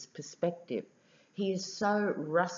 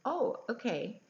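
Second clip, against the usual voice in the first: higher-pitched, mildly thrown response; mock taken-aback acknowledgement; a bit taken aback; 7 semitones higher, 4 dB quieter than usual. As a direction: imitate someone caught off guard. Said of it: "was a bit taken aback and went".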